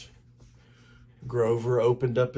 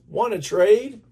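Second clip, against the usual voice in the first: put-on higher, louder excited boy; eager kid; 10 semitones higher, 7 dB louder than usual. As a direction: gush over the new box. Bill asks to trade.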